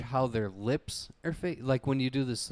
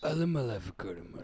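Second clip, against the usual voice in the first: Vin Diesel; 3 dB quieter, much faster than usual. Retold or imitated. Friend requested it, a husky Vin Diesel whisper. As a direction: murmur it like a tough guy. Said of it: "hear [name] do Vin Diesel".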